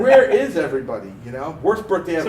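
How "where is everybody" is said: laughing through a louder "Where is everybody?". Retold or imitated. Retelling his lonely birthday at the hospital, laughing louder. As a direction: crack up as you ask "Where is everybody?".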